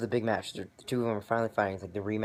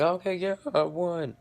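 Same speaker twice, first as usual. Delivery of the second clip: much higher, louder mock gloat; smug [name]; 9 semitones higher, 4 dB louder, slower than usual. [name] getting up and claiming the win, mock gloating. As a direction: gloat like you just won.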